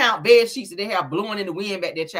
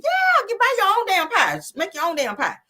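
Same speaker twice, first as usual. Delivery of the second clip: exasperated; 14 semitones higher, 4 dB louder than usual. Real, not acted. Exasperated retort about the pie.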